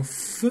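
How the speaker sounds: F said as its sound "feu", not the letter name; son F isolé. Shown as a demonstration. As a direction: sound out F with a drawn-out friction. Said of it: "Je vais mettre le son F". (F prononcé comme le son « fff-eu »)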